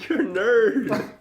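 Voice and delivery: laughing. Real, not acted. Laughing tease at friend.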